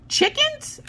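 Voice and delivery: high-pitched rising disbelief; incredulous question. Acted. Visitor finding ordinary chickens at a zoo, incredulous squeak.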